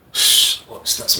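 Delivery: hushed, breathless shh; whisper. Retold or imitated. Narrator hushing Jeff through the cell door, whispered. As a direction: whisper it like you don't want the guards hearing.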